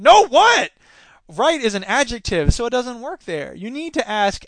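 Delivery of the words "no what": high, loud indignant outburst; indignant retort. Acted. Character challenges Patty's correction, indignant. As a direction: fire back in a loud, high voice. Acted retort in story dialogue.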